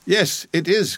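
mild pleading exclamation; urgent cry. A narrator's reading. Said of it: "cried he".

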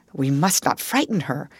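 plain reading voice

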